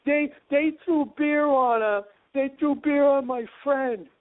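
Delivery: put-on high, slow tattling child voice; whiny eight-year-old. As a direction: whine it like a kid telling on someone. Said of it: "Like, like they were eight".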